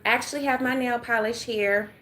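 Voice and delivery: normal talking voice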